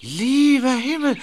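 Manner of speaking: surprised. Acted. Realizes they're the cousins.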